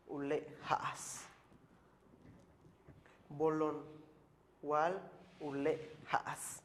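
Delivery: "Bolón wal ule haas" drawn out, each word separate and slow; slow modeled pronunciation. Shown as a demonstration. Teacher to students counting leaves in Maya, slow demo. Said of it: "teacher models Maya phrase".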